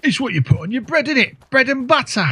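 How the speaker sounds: high, hammed-up Cockney caricature; mock Cockney voice. Player explaining batter as a Cockney, high mock Cockney accent. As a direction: squawk it like a cartoon Cockney. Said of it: "Obviously, I enjoy that voice".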